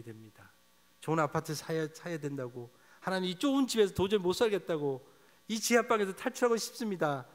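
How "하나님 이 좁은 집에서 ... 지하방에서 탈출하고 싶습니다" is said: pleading lament. Pretend. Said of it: "탄식하셔야 됩니다".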